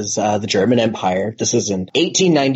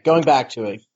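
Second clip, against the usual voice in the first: ordinary talk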